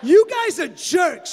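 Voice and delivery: very loud, slow furious yell; screaming. Acted. Daughter bellowing at her parents after the prank, very loud and slow. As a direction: bellow it out at them. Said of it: "she screamed".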